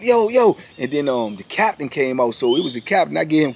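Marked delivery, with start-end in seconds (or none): 0.0-0.6 high, louder call to stop; stepping in with a sharp yo; alarmed call. Retold or imitated. Other officers calling out to stop the sergeant, high and loud. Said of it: "they was like".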